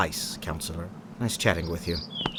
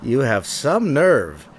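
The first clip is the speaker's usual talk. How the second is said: scoffing; 5 semitones higher, 3 dB louder, slower than usual. Acted. Chief scoffing, mocking laugh.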